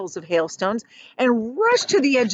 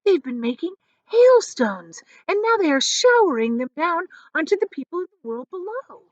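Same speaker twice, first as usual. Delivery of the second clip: excited; 9 semitones higher, 4 dB louder than usual. A narrator's reading. James explains excitedly.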